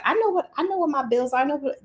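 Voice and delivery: protesting know-it-all. Retold or imitated. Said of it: "Some people like".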